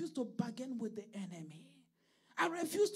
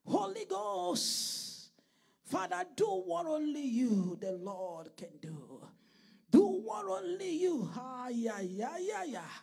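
loud drawn-out cries to God, falling away between; fervent invocation; 8 dB louder than usual. Real, not acted. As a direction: call on the Holy Ghost with full force. Calls on God in prayer.

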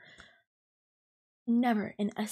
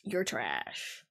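much lower, dismissive mock voice; mocking coworker; 10 semitones lower than usual. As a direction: drop the voice low and sneer it like him. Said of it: "giving me this attitude of".

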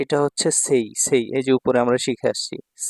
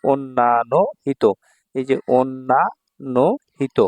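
slow modelling; slower than usual. Shown as a demonstration. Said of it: "teacher modelling a reading".

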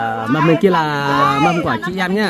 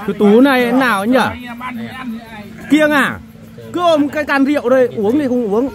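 teasing call; 12 semitones higher, 4 dB louder than usual. Real, not acted. Teasing Tú at drinking table.

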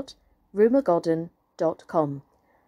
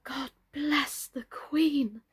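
soft slow whisper; 5 semitones higher, 3 dB quieter, much slower than usual. Acted. Totty whispering her blessing to herself, hushed. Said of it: "repeated blessing whispered".

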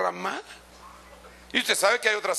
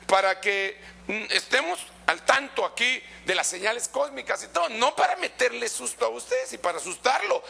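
usual speaking voice

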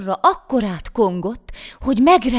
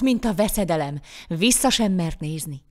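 usual speaking voice

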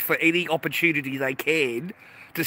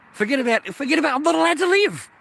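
angry outburst; 11 semitones higher, 5 dB louder, faster than usual. Real, not acted. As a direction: voice jumps higher and louder, fast, furious. Ranting at ex over seized pay.

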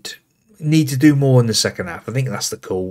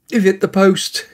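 excited exclamation; 7 semitones higher than usual. Real, not acted. Free kick hits the post.

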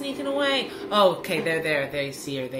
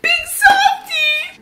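high, loud delighted cry; gleeful echo; 7 dB louder, slower than usual. Real, not acted. Fan echoes a line from the show, high and delighted. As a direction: cry it out, charmed.

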